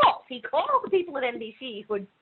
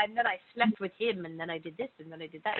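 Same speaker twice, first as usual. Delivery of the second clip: lightly voiced gossipy memoir read, dropping much softer; a breezy, confiding hint of a memoirist; mock memoir voice; 3 semitones lower, 5 dB quieter than usual. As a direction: parody a tell-all Hollywood memoir. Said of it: "Yeah, classic".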